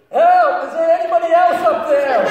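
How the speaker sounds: very loud, high shout upward; desperate shout. Acted. Man on the cliff yelling past God, very loud and high. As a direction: yell for someone else.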